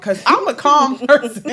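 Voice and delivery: normal talking voice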